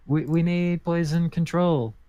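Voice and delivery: mock yell for urgent help; calling out. Player's character calls for aid for poisoned boss, restrained yell. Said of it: "I yell out".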